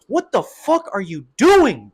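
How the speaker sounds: loud, slow, incredulous demand; exasperated yell. Real, not acted. Fan yelling at a fighter who stopped attacking, incredulous. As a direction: spell out the frustration word by word.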